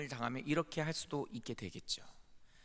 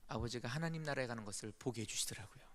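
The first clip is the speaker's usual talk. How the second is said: ordinary talk, nothing marked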